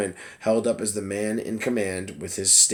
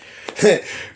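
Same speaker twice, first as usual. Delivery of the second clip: airy laugh at her own slip; breathy laugh; 10 semitones higher, 6 dB louder than usual. Real, not acted. Reader laughing after tripping over Cuomo's name, airy laugh. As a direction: laugh off the flub.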